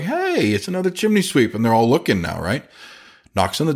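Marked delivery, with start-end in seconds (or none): 0.0-1.5 high mock exclamation; mock surprised call. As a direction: pitch it way up like spotting a rival. Techs spot competitor, voiced high.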